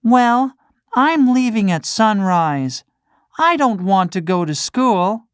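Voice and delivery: put-on high, defiant boy; defiant puppet boy. Pinocchio defying the cricket's advice, high and stubborn. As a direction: toss out the plan to skip school.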